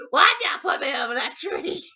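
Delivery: loud, high wailing imitation; hammed-up tearful complaint; mock crying. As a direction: bawl it like an upset little sister. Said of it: "She was all crying".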